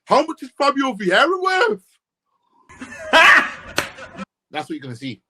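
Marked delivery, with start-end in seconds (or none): 0.0-1.8 high, loud rising mock question; mocking fan voice. 2.8-4.2 loud laugh. Real, not acted. Laughing at own parody.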